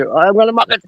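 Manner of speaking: lightly voiced Sunderland accent; a hint of the Mackem "make it". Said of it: "They say".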